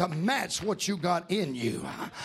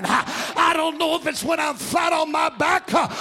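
loud, ringing gospel preaching over the organ; full-force preaching; 5 semitones higher, 5 dB louder than usual. Acted. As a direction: preach it out loud to the whole church.

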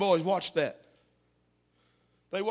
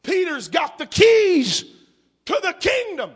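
loud, slow, higher proclamation; preaching shout; 13 semitones higher, 11 dB louder, slower than usual. Acted. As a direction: ring it out over the church. Preacher at full force.

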